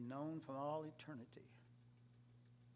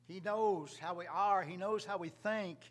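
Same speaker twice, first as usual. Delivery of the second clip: louder, higher, with weight on each phrase; emphatic preaching; 6 semitones higher, 11 dB louder than usual. Real, not acted. Preacher to congregation on Christ knowing us, emphatic. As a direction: preach it out with raised voice.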